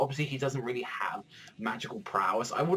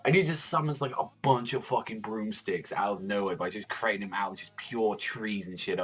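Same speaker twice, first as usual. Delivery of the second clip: normal talking voice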